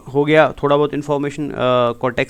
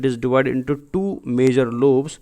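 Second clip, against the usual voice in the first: each word spelled out very slowly for notes; slow it right down so students can write; slow dictation; much slower than usual. Shown as a demonstration. Teacher dictating a definition slowly.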